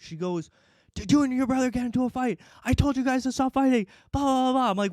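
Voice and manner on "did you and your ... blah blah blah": high, quick imitation of a scolding mom; retold frantic telling-off, pitched way up; mom freaking out. Mom accusing her sons of fighting, freaking out. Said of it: "she starts freaking out".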